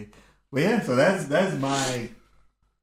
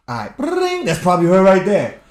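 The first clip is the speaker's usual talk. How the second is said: high, loud jolt of alarm; startled by the phone ringing; 7 semitones higher, 12 dB louder than usual. Retold or imitated. Rich startled by the phone ringing, high and loud.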